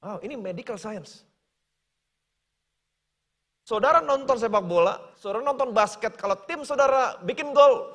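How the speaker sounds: ordinary talk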